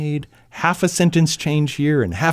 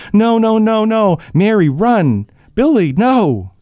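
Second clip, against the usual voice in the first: much higher, louder, terrified pleading; mother screaming as her husband is dragged away; panicked screams; 8 semitones higher, 4 dB louder than usual. Acted. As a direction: scream no at the attackers. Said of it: "W J's mother screams".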